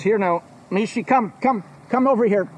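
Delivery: coaxing call to a dog; sweet high call to his dog Mishi. Acted. Calling his dog over.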